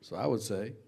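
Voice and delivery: usual speaking voice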